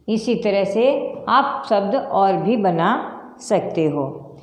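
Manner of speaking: ordinary talk, nothing marked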